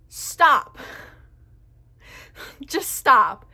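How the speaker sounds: loud, firm order to stop. Retold or imitated. Cashier telling a rude customer to stop, loud and firm. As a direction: shut her down, loud and final.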